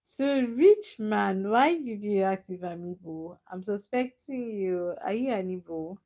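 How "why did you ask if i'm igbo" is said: ordinary talk, nothing marked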